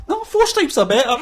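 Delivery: high, loud mum impression; mock-insist in a high mum voice; sketch mum. Mum defending four-stripe trackies to son, sketch mum. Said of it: "And then his mum's like".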